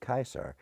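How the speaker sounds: Caesar said as KY-sar; Latin-style Caesar, KY-sar; Latin pronunciation. Shown as a demonstration. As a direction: say Caesar with a hard K, like Kaiser. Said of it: "is pronounced Caesar".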